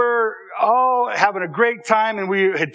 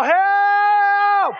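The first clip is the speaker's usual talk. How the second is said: yell for help; 11 semitones higher than usual. Retold or imitated. Trapped boy yelling for help. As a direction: shout for help from the outhouse. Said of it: "I'm starting to yell".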